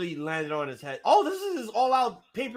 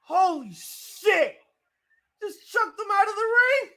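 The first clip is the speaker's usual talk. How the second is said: high, slow drawn-out amazed cries; stunned awe; 12 semitones higher, slower than usual. Real, not acted. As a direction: gasp it out, overwhelmed. Stunned by ring toss.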